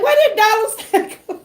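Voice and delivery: high and fast, breaking into a laugh; laughing disbelief. Retold or imitated. Streamer repeats her amazed question to chat, laughing fast. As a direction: rush the question as a giggle breaks through. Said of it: "I'm like".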